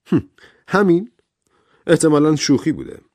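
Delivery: high, loud burst of relief; relieved. A narrator's reading. Doctor decides the recording was only a joke, relieved. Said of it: "با آسودگی آه کشید و گفت".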